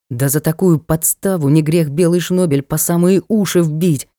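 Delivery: usual speaking voice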